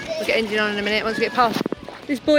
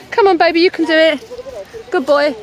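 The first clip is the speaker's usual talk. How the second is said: encouraging; 5 semitones higher, 7 dB louder than usual. Real, not acted. Cheering on tired child.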